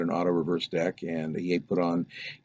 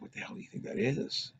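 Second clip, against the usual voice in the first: whispered, softer disbelief; hushed alarm; 4 semitones higher, 10 dB quieter than usual. Retold or imitated. Brother whispers in awe at the glowing sphere. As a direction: whisper it as if not to be heard.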